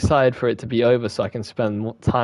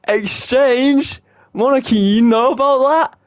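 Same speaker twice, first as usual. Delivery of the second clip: high, loud disbelief; shocked shout; 11 semitones higher, 5 dB louder, slower than usual. Real, not acted. Gamer shouting in disbelief at Monika.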